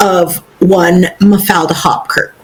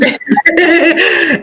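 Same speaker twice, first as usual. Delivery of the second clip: short laugh; 8 semitones higher than usual. Real, not acted. Laughs at own telling.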